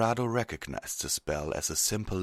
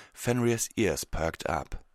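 ordinary delivery, nothing marked

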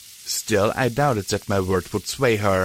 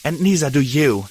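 angry; 4 semitones higher, 4 dB louder than usual. Acted. Dusk's furious accusation.

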